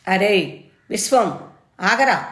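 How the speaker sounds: loud, high call to stop her son; mother's sharp shout. A narrator's reading. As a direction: yell at him to halt. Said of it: "గట్టిగా అరిచి చెప్పింది".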